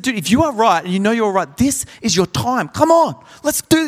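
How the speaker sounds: building from quick pep talk to a high excited push; hyping him up. Retold or imitated. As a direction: pump him up like a coach before a big play. Hyping up mate to stand.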